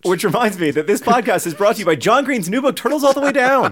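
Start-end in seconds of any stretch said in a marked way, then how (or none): 1.5-3.7 high, exaggerated sales pitch on the title; hammed-up book plug. Plugging his own new book to listeners, hammed-up high voice. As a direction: sell the title like a cheesy announcer.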